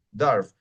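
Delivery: "dwarf" said "darf", W left out; low, falling "dwarf" without the W; silent W. Shown as a demonstration. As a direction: say "dwarf" as d-arf. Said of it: "es dwarf".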